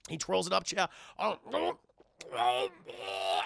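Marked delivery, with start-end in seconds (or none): 1.2-3.4 imitated gagging groans; strangled choking, sinking low; choking noises. Storyteller imitates the vampire choking on garlic, strangled. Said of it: "He starts choking".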